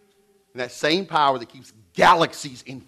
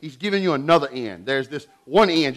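ordinary delivery

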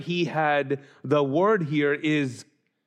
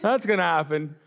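mock refusal; slower than usual. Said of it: "And I'm like".

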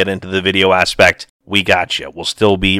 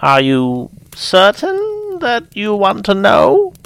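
high and slow, weighty; solemn elder, slow; old holy woman; 12 semitones higher, 4 dB louder, slower than usual. Acted. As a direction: warn her gravely, slowly. Old seer voiced in story.

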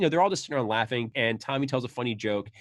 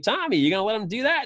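a hint of Jimmy goading, higher; Jimmy egging on; 9 semitones higher, 5 dB louder than usual. Jimmy goading Tommy against the kid, a touch higher. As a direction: needle Tommy, a bit louder. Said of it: "And Jimmy's like".

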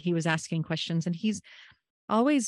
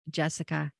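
ordinary talk

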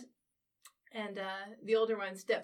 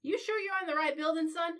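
put-on higher, talking down to a kid; condescending official; 7 semitones higher, 4 dB louder than usual. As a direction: patronise the lost stranger.

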